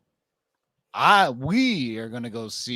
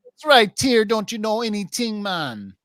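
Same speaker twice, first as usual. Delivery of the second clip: higher, louder repeat of the bit; louder put-on voice; 7 semitones higher than usual. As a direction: push the bit bigger. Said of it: "Again, again. one more time".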